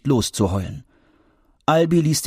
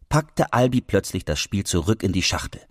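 usual speaking voice

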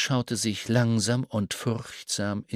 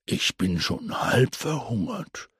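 put-on old grasshopper voice; 3 semitones lower than usual. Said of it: "sagte der alte grüne Grashüpfer".

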